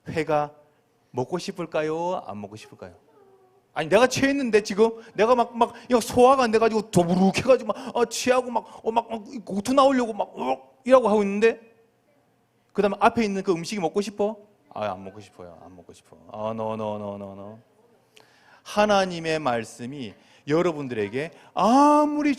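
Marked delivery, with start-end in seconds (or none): 3.7-10.7 hammed-up nausea, higher in pitch; queasy, bloated. Acts out indigestion and nausea. 14.7-17.6 low, very soft queasy refusal; weak refusal. Acted. Acts sick person refusing food.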